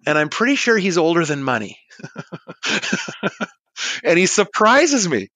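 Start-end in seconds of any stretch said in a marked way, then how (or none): 2.0-3.4 short, light chuckle; laugh. Real, not acted. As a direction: chuckle at your own joke. Laughs after own older-than-money joke.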